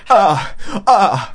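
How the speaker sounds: imitated groan. Son imitates father groaning on the phone, mock groan. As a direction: groan helplessly in place of talking. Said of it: "うめき声しか".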